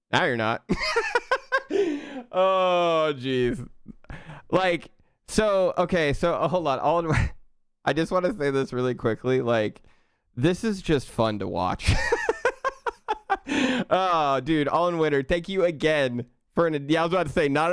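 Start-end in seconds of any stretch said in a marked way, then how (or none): 0.7-2.2 high-pitched laugh. Real, not acted. Streamer cracks up at chat. 12.0-13.8 high-pitched laugh. Real, not acted. Streamer laughing at gift.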